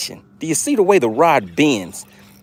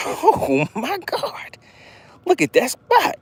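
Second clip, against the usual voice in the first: much higher, thrilled cry at the strike; excited yelp; 14 semitones higher, slower than usual. Real, not acted. Angler thrilled as a fish strikes his spot, excited high cry. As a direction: squeal with excitement.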